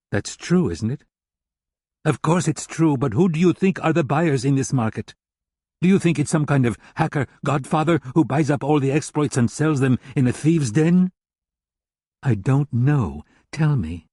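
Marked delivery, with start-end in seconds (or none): 2.0-11.1 lightly voiced scornful German hacker, a touch higher; a hint of a contemptuous German hacker lecturing. German hacker Grulich speaking.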